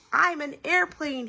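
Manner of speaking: put-on high, slow eager child's voice; excited child. A narrator's reading. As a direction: cry out like a small boy playing. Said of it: "he cried".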